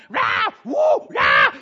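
mock toddler wails, loud and high; imitating a screaming toddler; toddler crying. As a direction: wail like a hurt toddler, hammed up. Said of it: "he's a crying".

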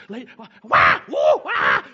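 loud mock wails cutting in; imitating a bawling toddler; toddler wailing. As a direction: bawl over the shushing. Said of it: "popped back up".

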